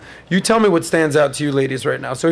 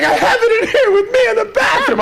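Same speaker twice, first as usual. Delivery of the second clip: much higher, wailing mock sob; shrieking fake-crying ex; mock hysterical girlfriend; 4 dB louder than usual. Man retelling his ex crying in the bathroom, mock wail. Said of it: "She's like".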